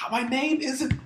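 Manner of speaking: high, drawn-out squeaky protest; squeaky mock yell. Picturing an actor as the shoplifter, squeaky mock yell. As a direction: imitate a squeaky kid yelling, without full volume. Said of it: "the squeaky voice ... just yelling".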